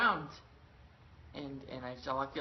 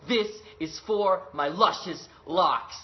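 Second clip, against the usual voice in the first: slow, loud, higher hammed-up war cry; comic battle scream; 5 semitones higher, 9 dB louder, slower than usual. Tarzan stabbing the leopard, slow hammed-up battle scream. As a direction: bellow it like a vengeful hero. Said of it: "I scream as I do it".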